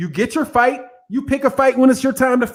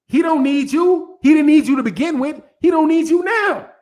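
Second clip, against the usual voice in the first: emphatic insistence; 7 semitones higher than usual. Real, not acted. Passionate rant about Deontay Wilder's wife.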